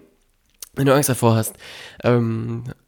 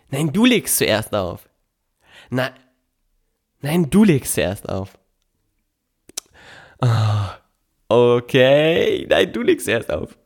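imitating mom on the phone; slower than usual. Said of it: "kurz meine Mutter imitiert, wie sie immer am Telefon".

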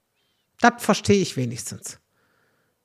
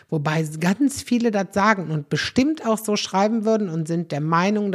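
ordinary talk

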